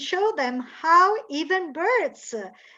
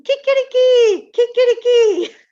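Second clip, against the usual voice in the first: high, loud crowing chicchirichì; Italian rooster crow; 10 semitones higher, 11 dB louder than usual. Shown as a demonstration. Teacher contrasting the Italian rooster, crowing loud. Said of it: "In Italian".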